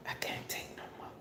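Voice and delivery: faint, drained whisper; exhausted whisper. Retold or imitated. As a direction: let it barely come out, spent.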